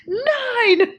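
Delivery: high mock scream with a laugh in it; screamed countdown. Excited boyfriend counting down to New Year's, mock scream. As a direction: shout the number high and giddy. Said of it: "scream right along with him".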